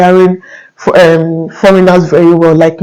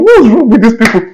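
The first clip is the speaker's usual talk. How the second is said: retold exasperation, pitched up; mock complaint, higher at the start; exasperated; 4 semitones higher than usual. Fed-up applicant complaining about the migration office, exasperated.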